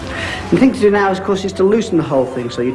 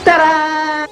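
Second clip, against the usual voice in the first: bright, high showman's flourish; triumphant ta-da; 12 semitones higher, 3 dB louder than usual. Acted. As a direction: announce the reveal with a flourish. Magician reveals trick.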